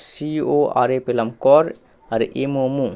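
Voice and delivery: সিওআরএ spelled out slowly. Shown as a demonstration. Teacher repeating for pupils, slowly. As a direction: say it again slowly for the class. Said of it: "আমি আরেকবার বলছি".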